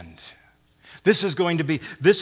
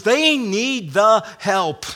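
"They need the help" slowed, each word stressed; urgent emphasis; 3 semitones higher, 4 dB louder, much slower than usual. Shown as a demonstration. Preacher stressing Paul's urgency, slow emphatic delivery. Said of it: "an urgency and a necessity here that he emphasizes".

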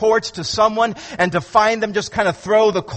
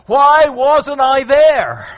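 Thomas wondering; 4 semitones higher, 5 dB louder, much slower than usual. Acted. Thomas asking himself.

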